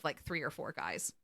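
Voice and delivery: ordinary talk, nothing marked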